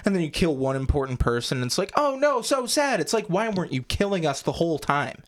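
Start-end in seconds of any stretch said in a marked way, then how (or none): 2.0-3.0 high, sarcastic lament; mock sorrow. Host mocking one important death, high fake sorrow. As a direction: coo fake sadness in a high pitch.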